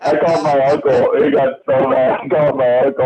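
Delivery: high, giggly delivery; talking through laughter. Real, not acted. Telling friends about pranking an uncle, laughing. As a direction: laugh as you get the story out.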